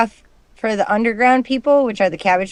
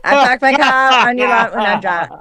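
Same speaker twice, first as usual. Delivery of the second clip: mock Boston accent. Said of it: "you have such an original Boston accent".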